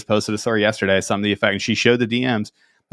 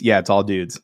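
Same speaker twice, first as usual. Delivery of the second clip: ordinary delivery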